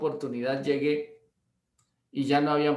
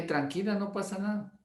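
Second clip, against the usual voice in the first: softer, soothing; calm reassurance; 5 semitones higher, 5 dB quieter than usual. Retold or imitated. Pastor calming the embarrassed wife, soft and reassuring. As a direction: gently settle her down. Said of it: "Le dije".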